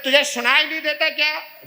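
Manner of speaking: acted high, loud scolding; angry shouting. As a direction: yell as if at someone who won't hear. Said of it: "तभी हम जोर जोर से बोलते हैं".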